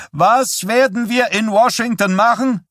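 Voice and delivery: a demagogue bellowing to a cheering crowd. A narrator's reading. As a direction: roar it at the crowd like a revival preacher. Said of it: "brüllte Stilson".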